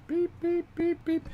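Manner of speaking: high-pitched beep imitation; imitated beeping. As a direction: beep like an alarm monitor. Imitating monitor alarm.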